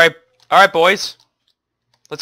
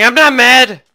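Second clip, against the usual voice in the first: loud denial; 9 semitones higher, 6 dB louder than usual. Real, not acted. Insisting while clearly mad.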